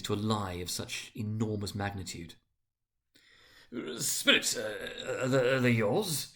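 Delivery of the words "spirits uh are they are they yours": faltering question. A narrator's reading. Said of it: "Scrooge could say no more".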